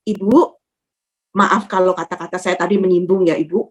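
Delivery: low-pitched, contrite address; gentle apology. Retold or imitated. Retold apology to the offended woman. (minta maaf lembut bernada rendah)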